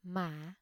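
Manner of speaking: "mã" dipping low, then rising; "mã" said softly with the dipping Vietnamese hỏi tone. Shown as a demonstration. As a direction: scoop "mã" down and back up. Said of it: "Low rising tone or thanh hỏi".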